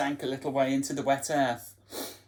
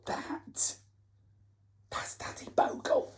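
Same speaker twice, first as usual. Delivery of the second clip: hushed reveal; 12 semitones higher, 6 dB quieter than usual. A narrator's reading. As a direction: whisper the name like a secret.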